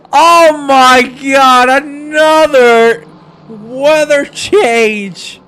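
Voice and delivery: loud, high, slow and stretched-out exclamation; astonished shout. Real, not acted. Commentator reacting to yet another weather change, astonished shout. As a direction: draw out every word in amazed shouting.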